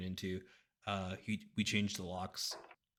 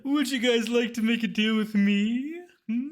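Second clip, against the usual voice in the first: high, loud, scheming merchant voice; oily salesman luring buyers; sly mysterious shopkeeper; 14 semitones higher, 12 dB louder, slower than usual. Acted.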